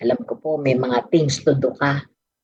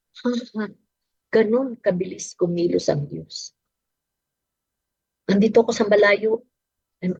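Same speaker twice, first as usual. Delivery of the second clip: ordinary delivery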